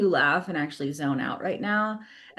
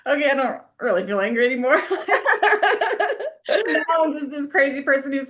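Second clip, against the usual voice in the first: quick, loud, giggly retelling; laughing; 7 semitones higher, 6 dB louder than usual. Retold or imitated. Retelling anger dissolving in the car, laughing. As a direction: rush it out through laughter. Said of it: "very quickly just started laughing".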